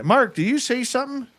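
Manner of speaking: high-pitched call across the woods. Retold or imitated. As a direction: call out to a friend far off. Calling to friend thirty yards away.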